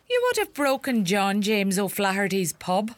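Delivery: usual speaking voice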